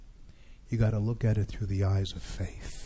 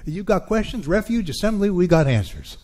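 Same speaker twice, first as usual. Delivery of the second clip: brand the church slogan with a louder, higher push; adapted slogan, louder; 6 semitones higher, 6 dB louder than usual. Acted. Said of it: "I'm gonna adapt that".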